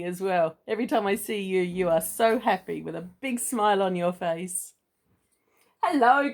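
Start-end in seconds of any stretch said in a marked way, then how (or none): 5.8-6.3 cheerful greeting. Acted. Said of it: "cried Mister Clever".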